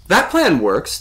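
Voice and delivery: high, loud excited echo. A narrator's reading. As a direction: answer eagerly, pitch and volume up. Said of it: "the voice said excitedly".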